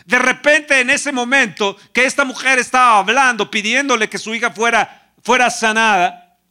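loud, driving sermon voice; fervent preaching. Acted. Pastor exhorting the congregation, loud fervent preaching. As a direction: preach it with rising fire.

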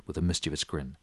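ordinary talk

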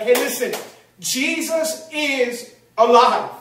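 slow, ringing announcement; emphatic proclamation. Acted. Mary bursting into the house with news, proclaiming. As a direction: proclaim it word by drawn-out word.

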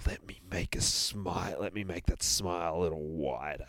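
soft, whispered threat; menacing whisper. Acted. Bear threatening to widen the boogeyman's smile, whispered. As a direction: whisper it like a killer. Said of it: "bear's menacing monologue".

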